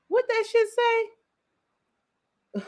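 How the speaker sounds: mock high slow voice. Mock reading aloud.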